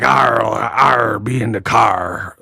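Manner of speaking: low mocking pirate voice; pirate impression. YouTuber mocks a boxer's dialect, pirate impression. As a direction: growl out the R's like a pirate. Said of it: "it sounds like a pirate".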